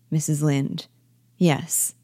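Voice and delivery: ordinary talk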